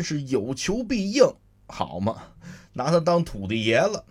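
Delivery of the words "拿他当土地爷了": usual speaking voice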